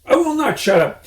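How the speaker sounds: high, loud squeaky shriek; shrieking Rat. A narrator's reading. Rat shrieks at Mister Fox to leave. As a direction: screech like an outraged rat. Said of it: "shrieked the Rat".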